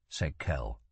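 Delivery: ordinary delivery, nothing marked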